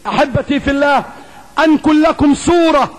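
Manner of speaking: ordinary delivery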